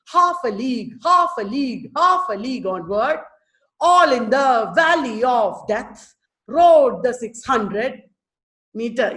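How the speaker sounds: "Half a league" drummed out, HALF stressed; like marching. Shown as a demonstration. Teacher reciting Charge of the Light Brigade, marching beat. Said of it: "sounds like marching".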